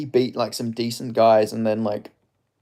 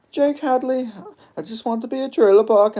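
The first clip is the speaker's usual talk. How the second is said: Kermit-like English accent, pitched way up; Jake Hadley accent; 12 semitones higher than usual. Shown as a demonstration. Streamer imitates fighter Jake Hadley for chat in a high Kermit-style English accent. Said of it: "such a difficult fucking accent to do".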